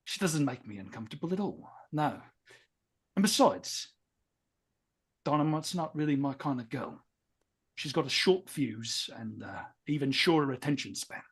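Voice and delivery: lightly voiced dismissive tough hero; in-character brush-off. Player brushing off interest in Dynamite, lightly in character.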